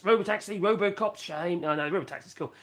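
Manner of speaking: ordinary talk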